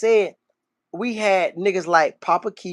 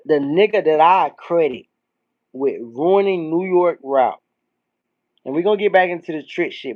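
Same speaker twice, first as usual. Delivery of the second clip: ordinary talk, nothing marked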